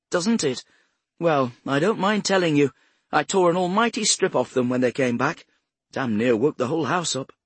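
high, loud, put-on indignation; forced anger. A narrator's reading. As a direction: protest crossly, overdoing the outrage a little. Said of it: "Swift's anger sounded forced".

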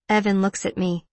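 ordinary delivery, nothing marked

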